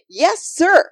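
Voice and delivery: put-on high, loud, eager child; excited exclamation. A narrator's reading. Sophie answers Yeshua as he leaves, exclaiming brightly. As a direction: pipe up loudly and obediently. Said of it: "exclaimed Sophie".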